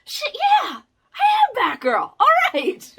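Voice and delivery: high laughing cheer; triumphant declaration. Pretend. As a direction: claim the name in triumph. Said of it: "it's like".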